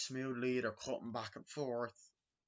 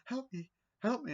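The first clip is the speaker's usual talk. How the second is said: high-pitched retold plea for help; mock panic; 13 semitones higher than usual. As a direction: imitate a panicked woman in a high voice. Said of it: "like when she's panicking".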